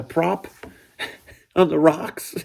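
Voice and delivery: chuckling through the words; laughing while telling. Real, not acted. Telling friends he wrecked the prop, laughing throughout. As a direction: laugh it out, embarrassed at the memory. Laughing at own boat mishap.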